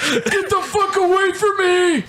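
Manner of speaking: put-on high shrieking old man; old man yelling. Playing an old person.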